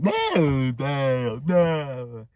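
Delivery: mock drunken drawn-out refusal; drunk whining. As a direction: imitate a tipsy uncle protesting. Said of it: "He was drunk or something".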